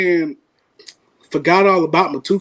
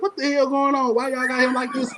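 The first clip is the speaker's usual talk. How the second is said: a hint of a suspicious mom, pitched higher; mock mom; 5 semitones higher, 4 dB quieter than usual. Mother questioning the boys at the door, lightly imitated. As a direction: lightly play his mother catching them sneaking in. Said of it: "And she's all like".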